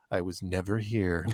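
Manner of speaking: slow, low and hushed; conspiratorial, softly dropped voice; retold secretive denial. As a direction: imitate someone slipping away unseen. Actor secretly denying his presence.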